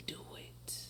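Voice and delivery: a faint whisper from inside; whispered inner voice. Pretend. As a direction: whisper it like a voice in your head. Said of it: "it's something in me that says".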